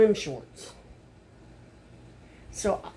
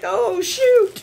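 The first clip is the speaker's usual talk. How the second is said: sudden high, loud "oh, shoot"; startled exclamation; 12 semitones higher, 9 dB louder than usual. Real, not acted. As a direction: yelp it in alarm. Ring stuck on finger.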